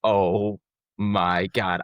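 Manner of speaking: very slow, sinking realization; dread. Retold or imitated. New hire realizing his boss is the ref, slow dread. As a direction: let the horror sink in slowly. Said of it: "And I'm like".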